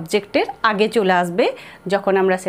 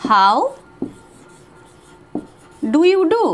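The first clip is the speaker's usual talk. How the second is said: word by word; 5 semitones higher, much slower than usual. Shown as a demonstration. Teacher drilling the formal greeting slowly. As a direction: split how from the rest. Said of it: "বা বলতে পারি".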